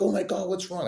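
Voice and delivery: high-pitched mock-shocked question; retold alarm. Onlookers seeing him bloody at the finish line, alarmed. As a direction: voice jumps up in imitated concern.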